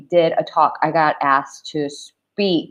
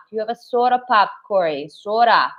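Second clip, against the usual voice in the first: ordinary talk, nothing marked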